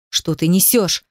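plain reading voice

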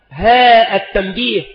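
ordinary delivery

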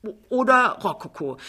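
lofty, high show-off; name the style grandly; proud, then faltering over it. A narrator's reading. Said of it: "sagt Frau Pinneberg stolz".

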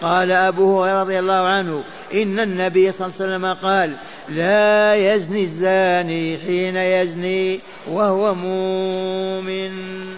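usual speaking voice

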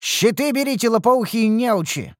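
high-pitched scolding call to the troops; sharp shouted order. A narrator's reading. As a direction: yell at clueless underlings, slowing on the insult. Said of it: "прикрикнул я".

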